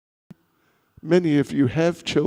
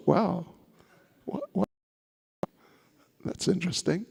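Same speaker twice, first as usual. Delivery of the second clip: hushed wonder; 9 dB quieter, faster than usual. Retold or imitated. Lady's amazed thought.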